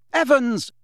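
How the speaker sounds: loud, high calling shout. A narrator's reading. James shouting after departing Lily, loud call. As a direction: call out after someone leaving. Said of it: "James shouted after her".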